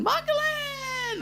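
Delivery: name sung out very high and slow; a long, high, happy call of "Michael Lane"; excited call. Retold or imitated. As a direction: call out the name like a delighted friend. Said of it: "Danny's gone".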